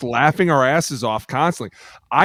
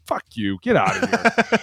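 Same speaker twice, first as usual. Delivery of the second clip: mock coach; 4 semitones higher, slower than usual. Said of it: "coach is just like".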